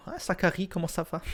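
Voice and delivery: high-pitched mock German accent. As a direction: greet Zachary in a put-on, high German accent. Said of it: "avec un accent allemand". (accent allemand, voix aiguë)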